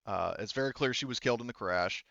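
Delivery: normal talking voice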